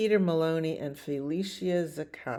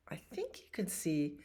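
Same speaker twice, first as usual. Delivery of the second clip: whispered aside; 7 dB quieter than usual. Real, not acted. Unsure aside about stars.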